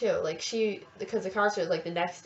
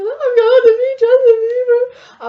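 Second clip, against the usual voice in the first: high, loud, slow teary shriek; crying with joy; 16 dB louder than usual. Retold or imitated. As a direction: blurt it out sobbing happily. Telling McDonald's cashier crying.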